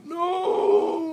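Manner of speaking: very high, loud crying shout; hysterical scream. Acted. Frank as dirt hits the lid, hysterical scream. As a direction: shriek in total despair. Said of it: "Frank hysterically".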